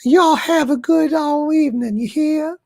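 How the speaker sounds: high-pitched Southern belle lilt; hammed-up falsetto belle. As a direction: play a gracious Southern lady, very high. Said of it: "Southern Belle Doomcock, folks".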